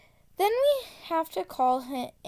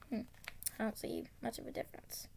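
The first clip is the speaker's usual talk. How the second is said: quick whispered aside; hurried hushed mutter; 3 semitones lower, 17 dB quieter, much faster than usual. Real, not acted. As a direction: toss it off under your breath.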